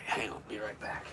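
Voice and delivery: quick whispered aside. Real, not acted. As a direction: whisper it off-mic. Stepping away from the mic.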